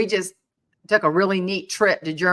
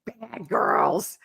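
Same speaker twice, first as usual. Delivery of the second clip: mock whisper; 4 semitones lower, much slower than usual. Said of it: "would he say".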